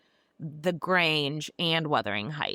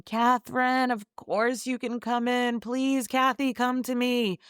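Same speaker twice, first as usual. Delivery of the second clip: hammed-up sobbing cry, pitched up; anguished shouting; 7 semitones higher than usual. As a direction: call desperately out of the window to a lost love. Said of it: "he's shouting".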